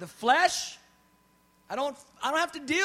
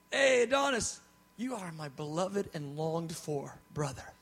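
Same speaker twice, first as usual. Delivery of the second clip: mock gushing; 7 semitones lower, 3 dB quieter than usual. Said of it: "what if I said".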